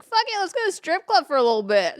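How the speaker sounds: loud, high tipsy enthusiasm; imitated party-night whoop of words; rowdy group decision. Retold drunken group decision.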